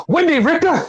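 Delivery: very high-pitched questioning retort; mock excited guess. Wrestler guessing who has arrived, mock excited question. Acted mistaken guess at a name.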